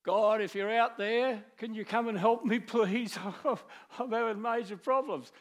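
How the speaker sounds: high, loud, offhand plea to God; mock casual prayer. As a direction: toss off a breezy help-me prayer, half laughing. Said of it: "the psalmist doesn't say".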